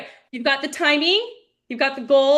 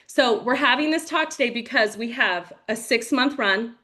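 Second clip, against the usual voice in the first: normal talking voice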